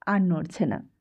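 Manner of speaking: ordinary delivery, nothing marked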